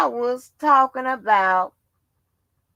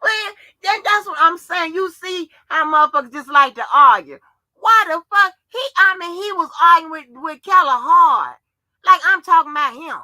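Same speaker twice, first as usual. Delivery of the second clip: loud, raised-pitch exasperation; worked-up ranting; 4 semitones higher, 7 dB louder than usual. Real, not acted. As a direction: rant loudly about the arguing. Worked up about the arguing.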